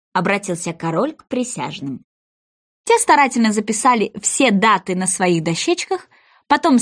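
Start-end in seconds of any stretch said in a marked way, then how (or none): none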